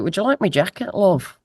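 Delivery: terrible mock Liverpudlian accent. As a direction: imitate a Liverpudlian, badly and lightly. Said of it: "I heard this Liverpudlian accent".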